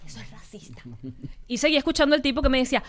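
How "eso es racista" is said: susurrado, casi sin voz; susurro. Retold or imitated. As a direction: dilo bajito, como en secreto. Retoma lo que le dijo. (murmullo confidencial muy suave)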